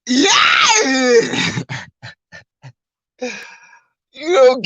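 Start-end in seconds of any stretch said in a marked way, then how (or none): 0.1-1.6 mock crying wail. Mocking the winner's crying with a high wail. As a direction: wail it out like a sobbing fighter.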